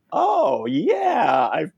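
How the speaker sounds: high sudden oh yeah; surprised realization. Retold or imitated. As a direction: act out remembering suddenly. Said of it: "And I said".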